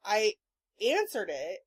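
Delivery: normal talking voice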